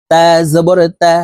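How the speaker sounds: তা with a light front-of-mouth t and open vowel; careful model of a thin Arabic তা. Shown as a demonstration. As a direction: keep both তা syllables light and fronted. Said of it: "তা হরফ পাতলাভাবে".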